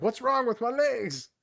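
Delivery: high, slow, bewildered whine; panicked athlete. Retold or imitated. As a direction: play a shaky athlete staring at his legs. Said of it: "like, shaking, like".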